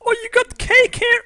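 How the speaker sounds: high, loud squeal of delight. Acted. Player voicing his Sim spotting cake, high and delighted.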